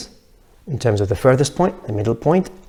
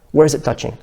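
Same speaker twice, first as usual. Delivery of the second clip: normal talking voice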